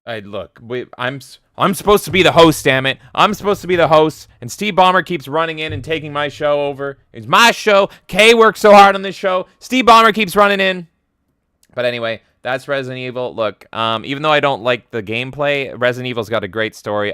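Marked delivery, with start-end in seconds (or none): 1.6-10.9 louder, higher mock indignant rant; mock outrage. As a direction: complain loudly in hammed-up frustration. Host mock-complains about losing show.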